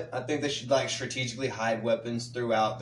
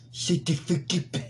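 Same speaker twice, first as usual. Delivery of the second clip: higher, mock cartoon rapper; 5 semitones higher than usual. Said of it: "[name], he'd be like".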